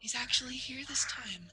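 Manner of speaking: hushed, whispery reassurance. Acted. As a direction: half-whisper it gently to a child. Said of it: "Amanda looks over and goes".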